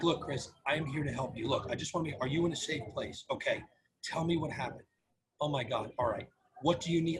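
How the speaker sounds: soft, low, reassuring voice; calm them with quiet, sincere concern; empathetic tone. Shown as a demonstration. Talking a hurt caller through the emergency, empathetic. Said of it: "my intonation".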